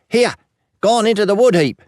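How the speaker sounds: put-on high, loud, urgent boy's voice; high-pitched young lad calling out; excited boy's yell. A narrator's reading. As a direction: shout the news like an excited urchin. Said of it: "yells the eldest boy".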